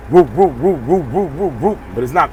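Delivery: rhythmic whooping imitation of an engine turning over; truck engine impression. Trucker imitating his engine not starting, rhythmic whoop. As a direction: mimic a truck cranking with a looping whoop. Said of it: "That's my impression of, of a truck starting".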